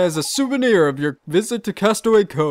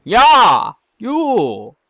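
loud emphatic yeah; insistent; 5 semitones higher, 9 dB louder than usual. Acted. Oliver insists to the peddler, loud and emphatic. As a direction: jab the word at him.

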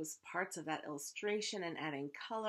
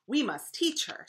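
loud, higher, emphatic declaration; resolute queen; 6 semitones higher, 9 dB louder than usual. A narrator's reading. Worried queen deciding to teach the princess, emphatic declaring. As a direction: declare it firmly, voice raised.

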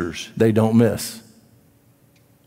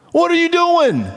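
incredulous challenge; 14 semitones higher than usual. Acted. Preacher challenging a passive churchgoer, high and incredulous. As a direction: throw the question out in disbelief.

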